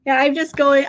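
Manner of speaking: ordinary talk, nothing marked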